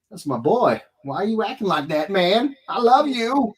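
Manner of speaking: put-on rising, fond plea to a baby son; dad pleading fondly with baby son, rising to a laugh; mock affectionate. Parent voicing to son, rising.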